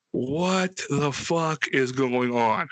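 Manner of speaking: very slow, stretched-out bewilderment; stunned disbelief. Retold or imitated. Tripping son alone on the porch, slowly stunned. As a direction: draw out every word, dazed.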